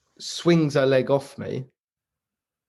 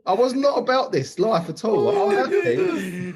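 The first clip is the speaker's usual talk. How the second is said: incredulous inner rant; 10 semitones higher than usual. Retold or imitated. Retold inner rant, high.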